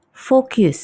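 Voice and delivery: focus mispronounced as "fokiu", slow; wrong pronunciation. Shown as a demonstration. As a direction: show the mistake: focus with a y-glide. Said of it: "jadi bukan".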